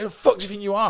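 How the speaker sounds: high-pitched angry retort; went mad. Retold or imitated. Retelling his clash with producers, mad high-pitched outburst. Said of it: "I just went mad".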